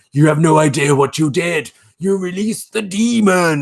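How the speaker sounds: mock ominous, drawing out the demon; spooky warning. People warning the demon releaser, in mock ominous tone. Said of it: "And people are like".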